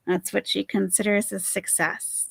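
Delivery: ordinary talk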